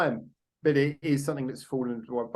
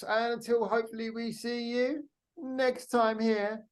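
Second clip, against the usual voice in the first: sign-off; 8 semitones higher, 3 dB quieter, slower than usual. Acted. Signature sign-off line.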